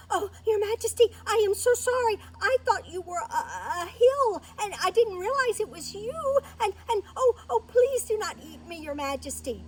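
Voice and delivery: high, loud pleading mouse voice; frantic, stammering little mouse; terrified mouse. Acted. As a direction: beg for your life in a high squeal. Frightened mouse begs the lion.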